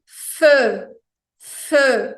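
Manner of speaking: feu mais alto, com o E francês de biquinho; pronúncia francesa. Shown as a demonstration. (feu em francês)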